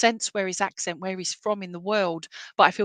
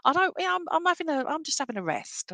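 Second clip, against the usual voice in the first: imitating Nan Flo; 9 semitones higher than usual. Nan in the corner waving attention away, high mock protest. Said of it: "and she's like".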